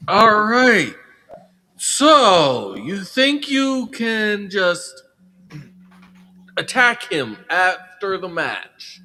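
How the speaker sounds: lightly acted authority, loud opening; showman's stern proclamation with pauses; stern general manager. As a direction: lay down the ruling in drawn-out phrases. Game character announces punishing match.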